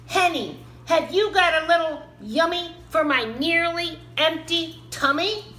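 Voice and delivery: high, loud wheedling elf voice; sing-song begging for a tummy-filler; hungry little Tomten. A narrator's reading. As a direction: coax for a snack in a piping little voice. Hungry Tomten asks for egg.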